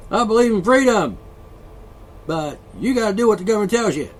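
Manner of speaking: high, loud mock believer; mocking quote. Ranting host mocking believers' words. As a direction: sneer out the hypocrite's line. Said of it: "people actually say things like".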